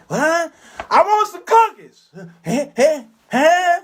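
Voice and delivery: loud high calling out; high shouted hey. Retold or imitated. Customer yelling at the clerk behind glass, high loud calls.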